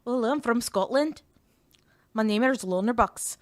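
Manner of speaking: loud, put-on Scottish accent; Loner Box. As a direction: introduce yourself in a mock Scottish accent. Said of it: "this is Boner Box's accent".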